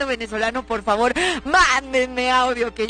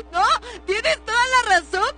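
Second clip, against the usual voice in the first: ordinary talk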